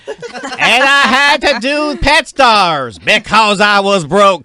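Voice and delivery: high, loud, slow catchphrase through laughter; big hammed-up catchphrase; catchphrase delivery. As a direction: sell it like a signature line. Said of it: "That's my new catchphrase".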